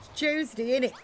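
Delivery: light cockney innit tag; London innit. Acted. Telling chat it's Tuesday in a light London voice. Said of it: "In it is more".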